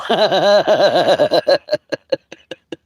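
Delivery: a big burst of high laughter; loud high laugh. Real, not acted. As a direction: cackle out loud at yourself. Laughs at own joke.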